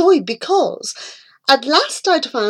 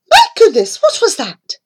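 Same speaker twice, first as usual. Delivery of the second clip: startled mother; 11 semitones higher, 4 dB louder, faster than usual. A narrator's reading. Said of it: "she cried".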